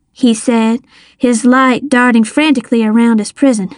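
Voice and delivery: plain reading voice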